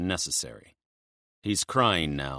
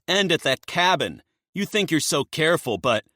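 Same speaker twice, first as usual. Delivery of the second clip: urgent taunting reveal; 11 semitones higher, 6 dB louder than usual. Acted. Locken pressing leverage.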